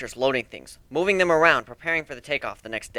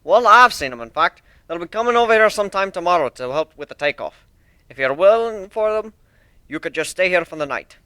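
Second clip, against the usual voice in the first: a touch higher, louder, boastful builder; lightly voiced proud shipbuilder; 6 semitones higher, 7 dB louder than usual. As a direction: show off your ship like a proud craftsman. Steelhorn invites them.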